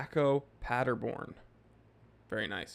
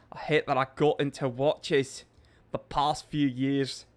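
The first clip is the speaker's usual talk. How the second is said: put-on a bit of an Irish accent, measured pace; light Irish accent; 3 dB louder than usual. Streamer reading Seamus's chat comment in a light Irish accent. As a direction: read it with a light Irish lilt. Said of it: "a little bit of an accent".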